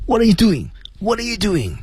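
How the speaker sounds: high, pinched squeak; throat pressed down. Shown as a demonstration. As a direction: squeeze the words through a tight throat. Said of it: "压着嗓，我们再压一下".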